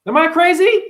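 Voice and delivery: squeaked high and loud; a squeaky self-mocking question. Mocking himself to the crowd, high and loud.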